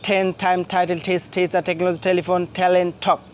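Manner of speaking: "টেন" through "ঠক" in one breath, each T aspirated as ঠ; one-breath drill. Shown as a demonstration. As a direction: rattle off "টেন টাইম টাইটেল" onward evenly without stopping, puffed T. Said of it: "এক নিঃশ্বাসে পড়ার চেষ্টা করি".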